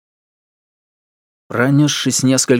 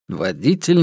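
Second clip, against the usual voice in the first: usual speaking voice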